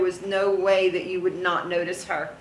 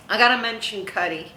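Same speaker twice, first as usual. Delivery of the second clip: normal talking voice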